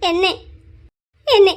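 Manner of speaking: ordinary delivery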